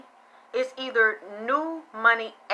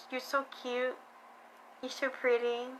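mock cooing; 3 semitones higher, 10 dB quieter than usual. People cooing praise at spoiled woman.

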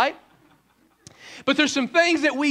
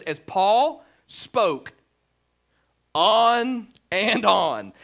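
very slow stretched "on and on"; drawn-out reading; 4 dB louder, much slower than usual. Acted. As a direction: drag it out like a droning sermon. Dramatic slow scripture reading.

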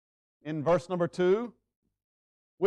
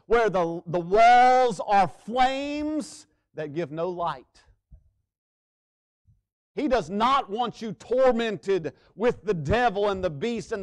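preaching at full force; 4 semitones higher, 6 dB louder, much slower than usual. Acted.

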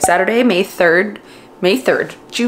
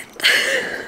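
teary laugh; 4 dB quieter than usual. Real, not acted. Laughing while crying over book.

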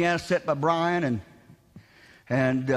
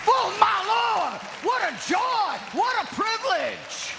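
raised, ringing sermon voice, higher than his chat; preaching; 10 semitones higher than usual. Acted. Pastor preaching to his congregation, in a raised ringing preaching voice. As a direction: preach it out to the church with full conviction.

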